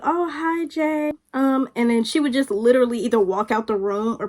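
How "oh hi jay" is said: higher, thin imitation of a friend's greeting; retold greeting. Friend greeting the speaker's mom, mock-polite. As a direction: mimic her token hello in a higher voice. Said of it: "she would be like".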